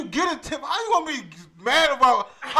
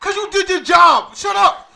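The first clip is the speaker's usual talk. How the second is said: loud, fast comeback; mock shouted retort; 5 semitones higher, 10 dB louder, slower than usual. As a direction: shout down the nail lady in mock outrage. Acted retort to nail lady.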